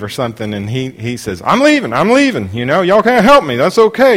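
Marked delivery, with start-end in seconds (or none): 1.4-4.2 loud, high huffy exit; loud sulky protest on leaving; indignant protest. Retold or imitated. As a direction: grumble loudly while being shown out. Man thrown out, protesting.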